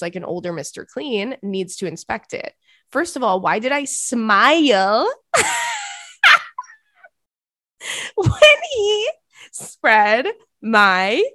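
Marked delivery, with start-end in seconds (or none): 3.4-11.2 loud, drawn-out reading through laughter; losing it mid-sentence, high and slow; cracking up. Real, not acted. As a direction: squeal the words out between laughs. Cracking up while reading email.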